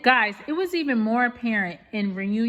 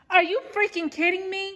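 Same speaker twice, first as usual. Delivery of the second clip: higher, pushed, furious disbelief; outraged outburst; 8 semitones higher than usual. Real, not acted. Slamming production over the missing footage, outraged outburst. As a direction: let the anger boil over on this. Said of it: "Let me calm down, 'cause I don't want my voice being messed up".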